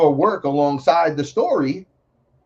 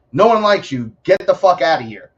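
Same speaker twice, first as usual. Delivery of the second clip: higher, louder mock online telling-off; angry telling-off; 4 semitones higher, 6 dB louder than usual. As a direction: snap it loud, like chasing someone off. Acted angry reply to posts.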